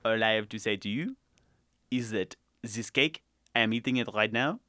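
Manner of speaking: low, soft, mock French accent; a hushed, deadpan fake French accent. Playing Jean-Pierre, a cake-eating Frenchman, in a soft mock French accent. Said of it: "My name is, uh, Jean-Pierre".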